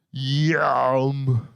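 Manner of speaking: drawn-out creepy "yum"; lecherous German leer, stretched out. Retold or imitated. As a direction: savour it like a creep. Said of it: "they're just needlessly creepy".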